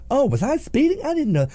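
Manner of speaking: high, mock-surprised innocence; feigned innocence. Speeder pretending to the officer, feigned innocence. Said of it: "You pretend".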